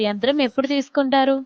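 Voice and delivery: normal talking voice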